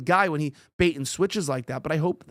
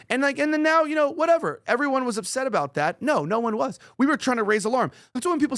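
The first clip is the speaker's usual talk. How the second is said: excited; 7 semitones higher than usual. Real, not acted. Ranting host builds up.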